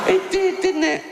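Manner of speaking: incredulous. Real, not acted. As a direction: act stunned by the claim. Incredulous reply to absurd claim.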